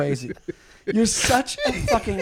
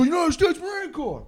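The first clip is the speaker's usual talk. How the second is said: imitated heckler yelling; 5 semitones higher, 4 dB quieter than usual. Drunk heckler yelling at a comic on stage, high mock yell. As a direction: mimic a drunk yelling out a slogan. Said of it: "this dude's just yelling out".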